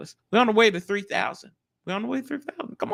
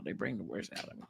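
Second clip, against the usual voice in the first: much softer, quicker repeat under the breath; muttered aside; 12 semitones lower, 17 dB quieter, faster than usual. Real, not acted. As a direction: mutter it again to yourself.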